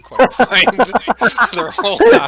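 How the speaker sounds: breaking into real laughter mid-sentence; laughing while narrating. Real, not acted. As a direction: crack up and struggle to finish. Game master cracks up while narrating.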